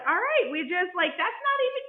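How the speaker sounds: high, fast, teasing comfort; playful reassurance. Retold or imitated. Friend brushing off a mistake, high and quick. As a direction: tease her kindly. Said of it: "It was more so like".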